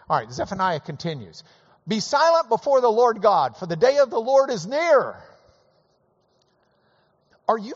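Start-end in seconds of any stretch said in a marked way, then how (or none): 1.9-5.2 loud, high proclaiming read; scripture reading. Acted. Preacher reading the verse loudly to the congregation.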